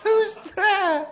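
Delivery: very high squeaky wolf voice. Acted. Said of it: "said the wolf in a squeaky voice".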